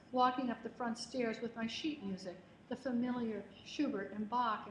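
ordinary delivery

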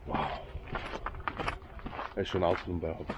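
ordinary talk

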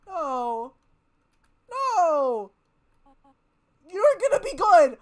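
loud, high cries of no; anguished shout. Real, not acted. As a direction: yell your protest at the screen. Player pleads with dying character.

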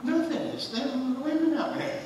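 high mocking blame voice; mock complaining wife. Wife mockingly blaming the other women.

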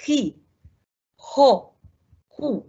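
"خي" and "خو" as isolated kh syllables; throat kh on each "خو"; jota vowel drill. Shown as a demonstration. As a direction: drill "خي، خو، خو" one by one, pausing. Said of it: "زي الخ بتاعت اللغة العربية".

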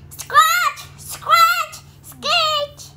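high, loud scratch scratch screech; scratchy hoof sounds. Acted. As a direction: squeal out the noise of hooves on wood. Goat hooves on bridge sound.

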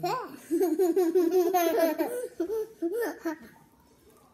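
chewing while humming; talking between bites of cake; mouth full. Real, not acted. Seen 0: Two children biting whoopie pies as "Mm" is said; speaker unclear.

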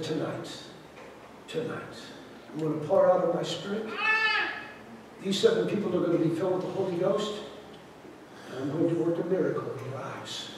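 softly voicing God's promise during prayer; hushed voice of God. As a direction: speak it as a sacred promise, barely above a breath. God speaking to him in prayer.

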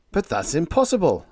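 a startled cry of disbelief; cried out. A narrator's reading. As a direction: protest it in shock. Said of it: "I cried".

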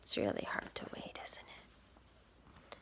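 quick hushed aside; fast whisper. Real, not acted. Aside to kids while waiting.

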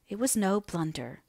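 ordinary delivery, nothing marked

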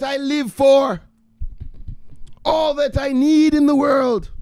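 high, slow, dramatic mama voice; doting mother. Pretend. Mother pleading with her son who hides downstairs, high and dramatic. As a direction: gush then nag like an overbearing mother.

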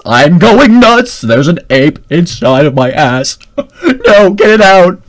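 mock-panicked loud yelling with a laugh. Joking to viewers about the ape, loud mock panic. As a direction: yell it out like you're losing it.

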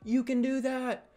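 high, loud fed-up voice; high loud mock refusal to a pestering boy; mock annoyed refusal. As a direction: brush him off in a high put-on voice. Refusing to help Zach.

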